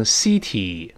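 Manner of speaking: "city" as "see-tee", long ee twice; wrong first vowel. Shown as a demonstration. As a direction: mispronounce "city" with ee in both syllables. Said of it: "更不能读成city".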